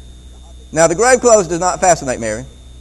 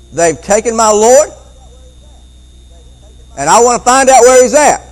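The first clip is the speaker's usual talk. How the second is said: distraught cry; 7 semitones higher, 8 dB louder than usual. Acted. Acts distraught Mary answering angels.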